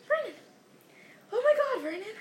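high-pitched cry of shock; choking alarm. A narrator's reading. Aunt reading letter calls husband, choking alarm. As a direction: cry out as if about to faint. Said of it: "and made a choking".

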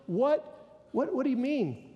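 feigned astonishment at the preacher; mock surprise.

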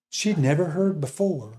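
normal talking voice

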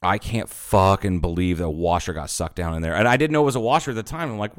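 ordinary talk, nothing marked

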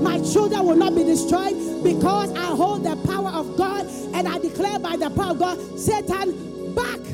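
preaching at full force. Real, not acted. Full-force declarations against Ebola.

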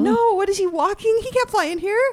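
high, shocked disbelief; horrified. Real, not acted. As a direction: squeal it in horrified surprise. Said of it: "I have never been so horrified".

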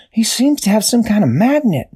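high, excited discovery; Dashy amazed at the magnet; surprised realization. A narrator's reading. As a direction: blurt out the discovery.

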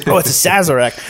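ordinary delivery

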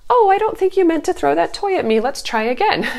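high, sugary, overly sweet correction; sweet as pie, despite the thrown toy; sweetly. Shown as a demonstration. Mom sweetly corrects a child who threw a toy, too sweet. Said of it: "this is not a time to sweetly say".

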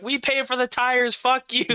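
high, joking yell; playful taunt at the cops; cheeky shout. Retold or imitated. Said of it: "and I'm like".

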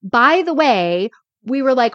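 ordinary talk, nothing marked

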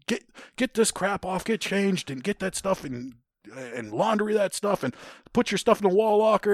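high-pitched imitation of barracks orders; retold sergeant's high, insistent commands; ordering drill sergeant.

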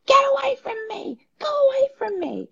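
high, loud mock child yell; imitating a screaming child. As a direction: shriek it like a furious little kid. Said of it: "their child is screaming at them".

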